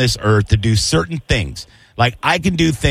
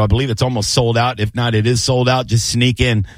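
ordinary delivery

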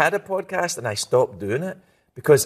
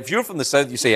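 normal talking voice

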